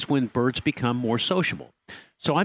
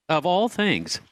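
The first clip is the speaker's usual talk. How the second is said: normal talking voice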